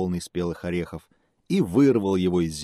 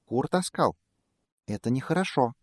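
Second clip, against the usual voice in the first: high, small squeaky mouse; 6 semitones higher than usual. Acted. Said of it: "пискнул мышонок".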